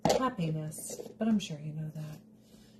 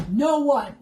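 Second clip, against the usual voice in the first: louder, higher, emphatic; emphatic put-down; 6 semitones higher, 8 dB louder than usual. Acted. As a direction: drive it home loudly. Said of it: "I'd be like".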